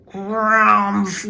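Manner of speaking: a bear grumbling hello at the door; grumpy bear grumble. A narrator's reading. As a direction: grumble it like a sleepy old bear. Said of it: "growled the old bear".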